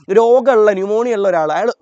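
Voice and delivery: normal talking voice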